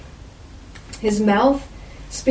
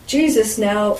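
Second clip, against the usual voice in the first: normal talking voice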